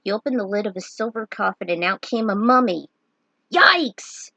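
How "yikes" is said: sudden high loud yelp; yelp of fright. Acted. Bartz startled by a mummy, yelping. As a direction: jump back with a startled cry.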